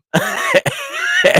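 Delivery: high, loud laugh; burst of laughter. Real, not acted. Recalling the linen room meetings, laughing. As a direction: break into laughter mid-sentence.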